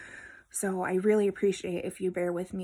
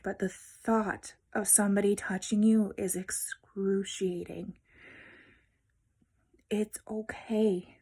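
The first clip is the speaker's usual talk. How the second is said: tender whisper. Real, not acted. Vlogger comforting fibro sufferers, in a tender whisper. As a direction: soothe them in a hush.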